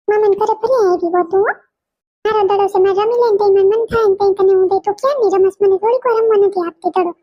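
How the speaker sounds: put-on a small girl who won't share her ball; little girl voice. Little girl bickering with her cousin over a ball.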